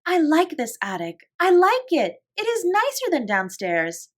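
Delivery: put-on high, eager little girl; Lottie's voice. A narrator's reading. Lottie tells Sarah she likes the attic, high and eager. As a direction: bounce it like a delighted small child. Lottie, small child, delighted.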